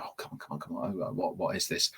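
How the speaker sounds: breathless whisper, straining to recall; frustrated student whispering to themselves. Retold or imitated. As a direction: whisper it under your breath like a stuck student. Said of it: "to go".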